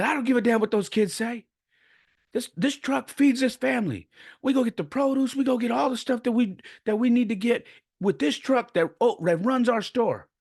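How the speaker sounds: high-pitched, imitated angry rant; worked-up mock tirade; grandfather losing it. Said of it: "freaking lost it on me".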